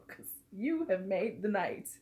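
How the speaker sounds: ordinary talk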